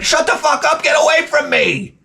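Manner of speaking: high-pitched, strained fed-up rant; exasperated outburst. Acted. Worker to hovering manager, exasperated outburst.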